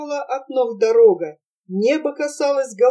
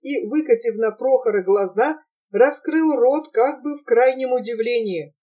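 ordinary delivery, nothing marked

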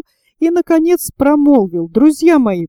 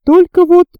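mildly embarrassed, higher-pitched opening; 7 semitones higher, 5 dB louder than usual. A narrator's reading. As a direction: broach it awkwardly, pitch raised. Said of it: "смущённо заговорил Пенкроф". (смущённо)

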